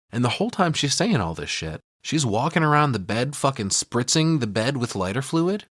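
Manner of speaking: ordinary delivery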